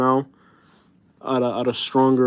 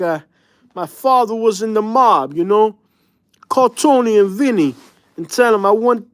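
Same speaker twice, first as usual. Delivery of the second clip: high-pitched mock New York mobster accent; 6 semitones higher than usual. Imitating New Yorkers met in Florida, in their New York accent. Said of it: "they have that accent".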